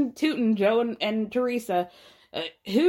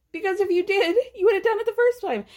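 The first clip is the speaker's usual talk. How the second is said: emphatic rant; 9 semitones higher, faster than usual. Real, not acted. Podcaster ranting at a cast member, rising and fast. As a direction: push the point hard, climbing higher.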